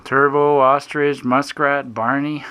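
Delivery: loud roll call; shouting names across group. Real, not acted. Announcing horse names to group.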